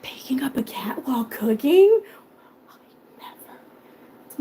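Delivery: hushed whisper, fading out. Real, not acted. Vlogger whispering a denial to a chat question. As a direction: whisper it like a secret.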